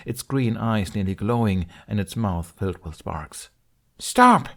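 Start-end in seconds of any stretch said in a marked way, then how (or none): 4.0-4.5 high, loud desperate yell; shout. Acted. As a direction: scream at him to stop. Shouting at charging dragon.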